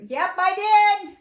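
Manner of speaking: high, loud and slow, imitating an insistent reply; mock-insistent, stretched out and loud; emphatic yep. Retold kitchen exchange with child.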